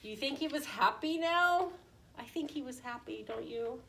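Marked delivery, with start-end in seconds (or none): none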